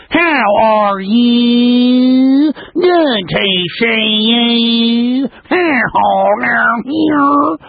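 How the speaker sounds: stretched, high, mocking Southern twang; mock Southern drawl. Host mocking a candidate's folksy accent, stretched and high. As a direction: drag out each word like a fake Kentucky accent. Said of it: "She comes to Texas and she talks like this".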